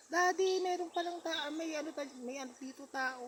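put-on very high, loud frightened child crying out; retold child's scream. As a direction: shriek for daddy like a terrified little girl. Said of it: "Sumigaw na naman daw". (napakataas na sigaw ng takot na bata)

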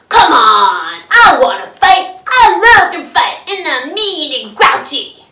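loud, mean bragging; grouchy boasting. Acted. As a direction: brag how tough she is. Ladybug boasts loudly.

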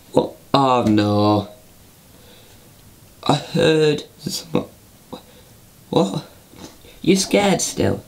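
high squeaky toy voice; puppet voice. Acted. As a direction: talk like a cheeky teddy. Toy voice held in all lines.

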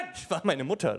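usual speaking voice

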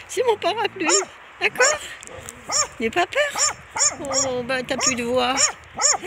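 high, loud pet-talk voice; high-pitched calling out to a guard dog. Real, not acted. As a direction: squeak up at the dog to win it over. Reassuring the frightened dog.

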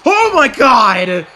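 loud, high, drawn-out shout of amazement; astonished ringside commentator yell; excited shout. Real, not acted. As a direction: cry out at a stunning move. Stunned reaction to wrestling move.

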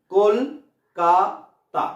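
কল, কা, তা with pauses; three-part slow demonstration of কলকাতা; syllable-by-syllable. Shown as a demonstration. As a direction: say কলকাতা very slowly in three separate beats. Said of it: "কলকাতাকে উচ্চারণ করো".

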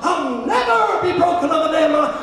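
high, loud fervent cry; imitated shout. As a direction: mimic someone yelling a declaration of faith. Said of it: "she's going".

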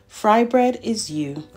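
plain reading voice

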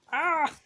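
short high, loud "ah!"; exasperated yelp. Real, not acted. Vlogger who can't get rid of an itch, high yelp. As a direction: yelp out in sudden irritation.

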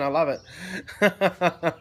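slow, amused, laughing through the words. Real, not acted. Livestreamer laughing at a viewer's funny username, laughing. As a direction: chuckle it out, tickled by the name.

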